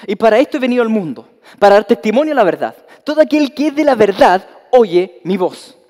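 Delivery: a preacher voicing Christ's bold declaration; Christ proclaiming. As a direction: declare it with full authority.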